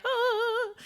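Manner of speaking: high, held angelic note; mock heavenly "ah". Star comes gloriously up the stairs, mock-heavenly "ah". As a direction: sound a glorious entrance on one high note. Mimics star's glorious entrance upstairs.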